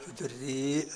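ودري drawn out on the i, then a glottal stop; slow ودري, closed with a hamza. Shown as a demonstration. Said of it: "بالهمزة". (ودري بالهمزة في الآخر)

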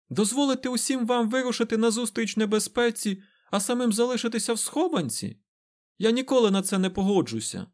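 high, forceful protest; indignant outcry. A narrator's reading. Egwene refuses to hide while friends face danger, indignant. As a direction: object hotly, pitch held high. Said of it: "вигукнула Егвейн".

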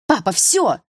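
exasperated daughter's outburst; angry cutoff. A narrator's reading. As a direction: put a firm stop to it. Slams glass, cuts father off.

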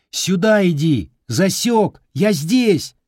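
high, loud calling out; shouting over wind. Acted. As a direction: call out across the field. Calling across distance.

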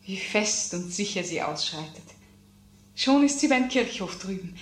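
ordinary talk

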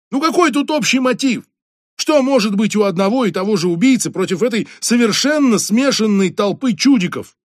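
high, loud frustrated pleading; exasperated outburst. A narrator's reading. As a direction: vent at the chief, barely holding back. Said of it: "чуть не заорал он". (раздражённо, на повышенных тонах)

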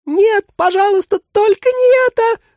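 put-on loud, very high childlike shriek; squealing mannequin begging in panic; horrified exclamation. A narrator's reading. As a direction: cry out in a tiny terrified voice. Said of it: "воскликнул сиреневый манекен".

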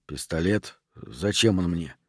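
low, soft questioning; taken aback, asking it under the breath; quiet puzzlement. Acted. Rent answers the milkman's offer of a pistol, quietly puzzled.

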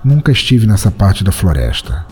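ordinary talk, nothing marked

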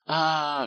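high, long "aah" of joy; drawn-out cry of recognition. A narrator's reading. Fat servant spots an old acquaintance, crying out. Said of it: "вскричал толстяк".

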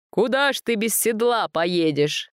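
bright, merry question; cheerful old god teasing. A narrator's reading. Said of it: "спросил весело Световид".

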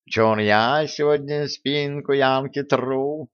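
slow, teasing brag; cat boasting to annoy Vitalik; cheeky claim. A narrator's reading. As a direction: drawl it out to needle the rival. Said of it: "нахально заявил Васька".

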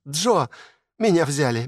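high joyful squeal of good news; визг от радости. A narrator's reading. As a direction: shriek with delight, news of a lifetime. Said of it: "и завизжала от радости". (радостный визг)

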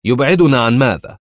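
سؤال عصبي; tense demand. A narrator's reading. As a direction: press her impatiently. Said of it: "فسألها في عصبية".

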